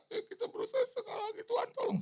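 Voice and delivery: very high, fast mocking voice with laughter; mocking the pastor's plea, high and laughing. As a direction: lampoon him while laughing.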